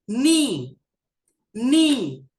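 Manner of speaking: teacher lays out नी slowly as a bad sound. Shown as a demonstration. Teacher demonstrates नी drawn out. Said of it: "अच्छी sound नहीं है".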